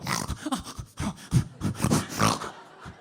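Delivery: low, breathy, rattling snore sound; imitated apnea snore. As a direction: mimic a sleeping man choking on a snore. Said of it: "and he'd go".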